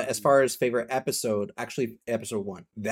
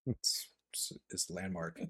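breathy laugh into a whispered aside; 3 semitones lower, 12 dB quieter, slower than usual. Real, not acted. Teasing a friend about agreeing with Angel, laughing whisper. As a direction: whisper it while still laughing.

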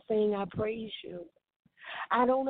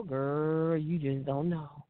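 low-pitched mock lament; imitated woe-is-me grumble; mock complaining; 6 semitones lower, slower than usual. Mock complaint she advises against.

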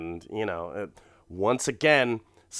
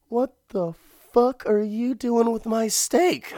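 much higher, louder, outraged squawk; indignant disbelief; 12 semitones higher, 4 dB louder than usual. Acted. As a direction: squeal it in shocked outrage. Voicing husband's outrage at dog.

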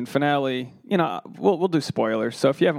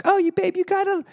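much higher, sing-song smug married-person voice; mocking married couple. Comic parodying a smug married couple, much higher and cooing. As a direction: squeal it like a gushing spouse lecturing singles. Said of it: "annoying when people are married and like".

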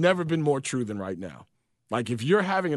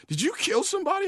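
much higher, shocked mock masseuse; a hammed-up alarmed question, pitched way up; faster than usual. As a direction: play the startled masseuse. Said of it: "She goes, like".

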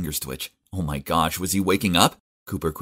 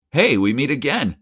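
bright, louder call across the entrance; 8 semitones higher, 5 dB louder than usual. A narrator's reading. Narrator calling out to Cooper, cheerfully. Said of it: "I called out".

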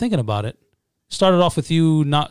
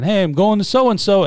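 higher, slower boasting quote; mock announcement; 7 semitones higher, 4 dB louder than usual. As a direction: imitate someone telling everyone their plans. Imitating people announcing travel plans.

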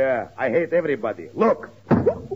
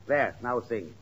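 ordinary delivery

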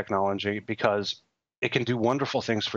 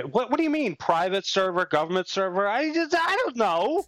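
much higher mock-befuddled voice, slowing at the end; mocking a clueless politician; 8 semitones higher than usual. Mocking the candidate who didn't understand her cell phone, high and befuddled. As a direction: play a flustered candidate baffled by servers.

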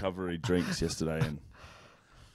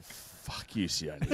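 mock curse; 4 semitones lower, 10 dB quieter, much slower than usual. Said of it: "And then I was like".

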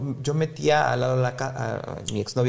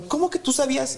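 high, indignant mock surprise; mock shocked mom; 12 semitones higher than usual. Mom asking dad how he knew, mock shocked. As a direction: play mom's disbelief lightly.

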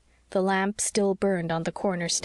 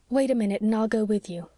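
plain reading voice

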